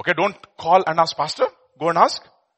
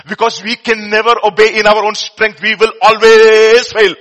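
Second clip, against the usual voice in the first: loud, slow emphatic preaching; preaching loudly; 6 semitones higher, 10 dB louder, slower than usual. Real, not acted. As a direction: hammer the point home. Preaching at full force.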